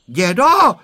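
loud, high cry for grandpa; frightened cry. A narrator's reading. Boy calling grandpa as the goat approaches, crying out. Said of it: "vykřikl Honzík".

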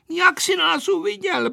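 put-on very high, angry girl; trembling with anger. A narrator's reading. Aneška questioning Vojta, angry. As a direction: demand it as a girl holding in rage. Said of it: "Její hlas se chvěl zlostí".

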